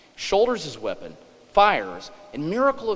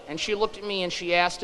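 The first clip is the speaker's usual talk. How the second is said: ordinary delivery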